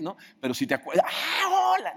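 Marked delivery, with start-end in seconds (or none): 1.1-1.9 high, bright exclaimed hello; suddenly recognize a friend and answer; surprised recognition. Retold or imitated. Passer-by recognizing the friend who called out, surprised.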